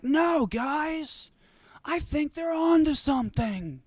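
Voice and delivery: pleading Joe voice. Acted. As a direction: beg the guys to listen.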